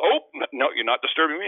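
lightly sarcastic polite host; mock politeness. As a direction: sweetly pretend it's fine. Talking to people opening bathroom.

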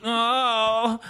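high mock host voice; hammed-up self-doubting host; mock documentary host. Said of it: "why are you making a documentary".

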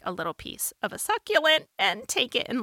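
usual speaking voice

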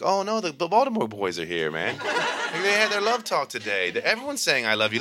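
high, laughing, gossipy retelling; a teacher spilling a funny story; amused teacher. Retold or imitated. Teacher voiced retelling the gym story.

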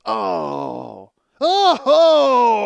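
loud rising shriek of disgust. Retold or imitated. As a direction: shriek out in revulsion. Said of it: "it was like this noise, like, everybody just goes".

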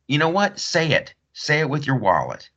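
normal talking voice

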